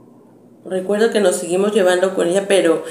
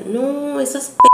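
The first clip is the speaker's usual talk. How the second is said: high mock yell, cut off mid-insult; retold yelling; 8 semitones higher, 4 dB louder, slower than usual. Neighbor yelling from her yard about the girls, mock yell. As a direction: yell it out, then bite the word off. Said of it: "cómo gritaba y decía".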